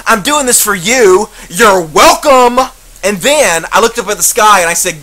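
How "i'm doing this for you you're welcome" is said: slow, slightly louder, condescending martyr; smug disgust. Retold or imitated. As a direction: drawl it out down at them, superior and put-upon. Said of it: "looked down upon them in disgust".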